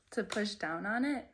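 ordinary delivery

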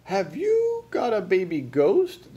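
high, slow, amazed question; crying out. A narrator's reading. Lady visitor hears a coo in the cradle, crying out. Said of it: "the lady cried".

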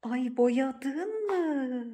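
very slow, drawn-out lament; dismayed wail. Real, not acted. Owner seeing her painted jug, dismayed drawn-out wail. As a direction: stretch the question into a long wail.

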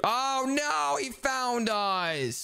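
high, drawn-out cry of alarm; dismayed alarm. Real, not acted. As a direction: wail as the monster finds you. Monster finds them.

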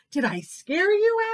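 put-on hopeful high kid-tiger voice; eager little tiger. A narrator's reading. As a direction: ask in a small, high voice. Little Tiger asks Elephant.

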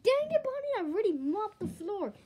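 high, loud telling-off; scolding. Acted. Friend scolds Bonnie for dropping the burger, loud.

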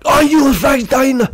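high, loud put-on British yell; mock British outburst. British version of the angry choker, mock yell. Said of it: "That's British, like".

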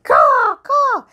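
high, loud bird squawk; noisy raven. Pretend. Grandparent's raven butts into the bedtime show, noisy squawking. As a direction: caw out like a pushy crow. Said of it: "he's really a noisy bird".

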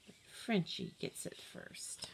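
voiceless whispered reveal. A narrator's reading. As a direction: hush it like a secret. Sly reveal of dog.

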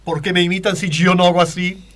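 hammed-up indignant diva. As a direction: protest with a star singer's exaggerated grandeur. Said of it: "Pero sin embargo él dice".

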